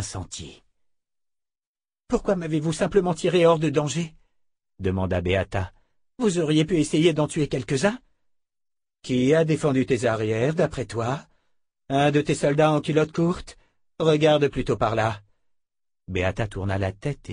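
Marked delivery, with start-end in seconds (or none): 2.1-4.1 put-on higher, plaintive girl's voice; a frightened girl demanding why; young soldier, hurt. A narrator's reading. Young Beata questions her. 6.2-15.2 higher, biting rebuke; mock her naivety; scornful rescuer. A narrator's reading. Blonde rebukes Beata.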